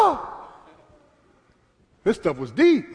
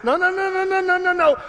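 high, loud, drawn-out shout; full-force preaching; 8 semitones higher, 5 dB louder, slower than usual. Real, not acted. Preacher shouting to the congregation that God's love encompasses pain. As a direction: hammer it home to the church.

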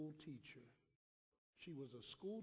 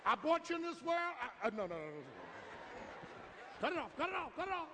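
high, loud whooping preacher; preaching at full force, loud and high; 10 semitones higher, 9 dB louder than usual. Real, not acted. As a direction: shout it to the congregation.

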